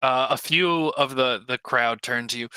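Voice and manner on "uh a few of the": usual speaking voice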